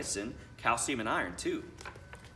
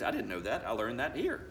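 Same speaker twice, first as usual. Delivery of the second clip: ordinary delivery, nothing marked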